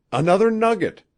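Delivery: high, loud, thrilled cry; excited exclamation. A narrator's reading. Wabi seeing Rod's pan of gold, excited exclamation. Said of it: "exclaimed Wabi excitedly".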